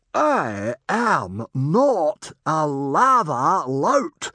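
very slow, high, loud denial; full-volume howl. A narrator's reading. Stranger shouts through his headgear, howling. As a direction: howl each word to be heard. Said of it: "howled the man as loud as he could".

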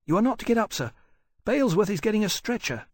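put-on insistent boy. A narrator's reading.

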